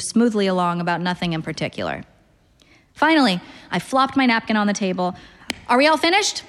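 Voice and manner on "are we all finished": higher, pointed question; brisk wrap-up question. A narrator's reading. Diner wanting to end the dinner, brisk. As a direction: pitch it up to close things. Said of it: "I hadn't meant it like that".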